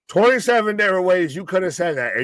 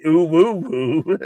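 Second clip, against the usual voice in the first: teasing imitation of a half-hearted hype call; mock his little woo-woo; playful copycat hooting; mimicking co-host's woo; much slower than usual. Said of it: "You said that like".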